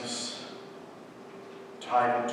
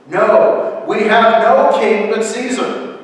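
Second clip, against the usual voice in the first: high, loud defiant shout; crowd shouting; 10 semitones higher, 14 dB louder than usual. A narrator's reading. Crowd rejects Jesus before Pilate, shouting. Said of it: "the crowd started shouting out".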